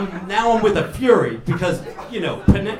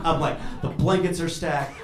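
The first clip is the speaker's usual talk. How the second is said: ordinary talk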